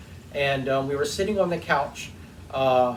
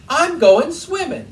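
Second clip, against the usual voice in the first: much higher, louder, slow and determined; defiant mother-in-law; 12 semitones higher, 7 dB louder than usual. Retold or imitated. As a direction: insist slowly, high and loud. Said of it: "She goes".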